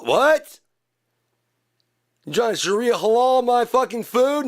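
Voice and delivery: high indignant yell; outraged shout. Acted. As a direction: bark it in outrage. Outraged character voice.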